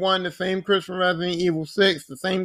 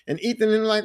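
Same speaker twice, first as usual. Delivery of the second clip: ordinary delivery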